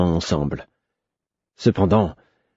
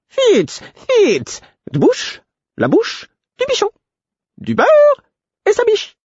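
shrieky-high, insistent fluting bursts; excitable fool, extremely high-pitched; piping fool's voice; 3 dB louder, slower than usual. A narrator's reading. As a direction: fire out separate piping cries, very high. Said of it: "d'une voix flûtée".

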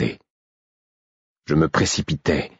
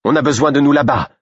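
high, loud call; raised voice over the battle; urgent declaration; 9 semitones higher, 4 dB louder than usual. A narrator's reading. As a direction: point and urge them onward. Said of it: "déclara Félicité le doigt tendu".

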